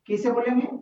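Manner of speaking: ordinary talk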